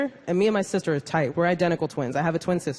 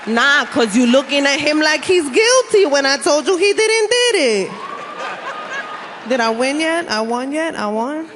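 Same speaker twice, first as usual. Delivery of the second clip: put-on high, loud courtroom protest in thick Puerto Rican accent; Puerto Rican-accented lawyer; 6 semitones higher, 4 dB louder than usual. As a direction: argue indignantly in a hammed-up Puerto Rican accent. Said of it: "your lawyer's like".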